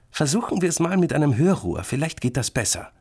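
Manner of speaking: put-on high, lively girl's voice; suggesting girl. A narrator's reading. Sister proposes an ear trumpet, bright suggestion. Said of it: "schlägt Penny vor".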